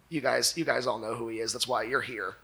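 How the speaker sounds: ordinary talk, nothing marked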